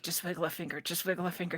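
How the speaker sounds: hushed, urging herself on; whisper-soft pep talk to your frozen body; quiet self-coaching. Retold or imitated. Trapped in sleep paralysis, urging herself quietly.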